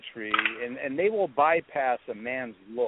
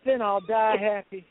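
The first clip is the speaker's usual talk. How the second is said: imitated woman, higher pitched; smitten admirer; 7 semitones higher, 3 dB louder than usual. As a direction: play her blissfully undeterred reply. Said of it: "And she said".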